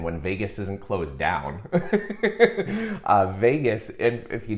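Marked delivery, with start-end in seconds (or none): 1.7-3.0 high, loud chuckling burst; real laugh at your own remark. Real, not acted. Speaker jokes to viewers about Vegas being closed, loud high laugh.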